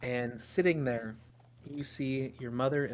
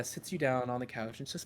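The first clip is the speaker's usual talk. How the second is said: usual speaking voice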